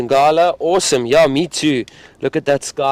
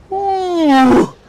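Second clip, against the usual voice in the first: very high, loud hooting roar sound; imitated lion roar; 6 dB louder than usual. Guide imitates the lion's roar for viewers with a high hoot. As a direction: let out a high lion call. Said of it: "So it's".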